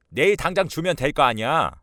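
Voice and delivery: irritated mother-in-law, high and loud; defensive outburst. Acted. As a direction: snap back at the accusation. Mother snaps back.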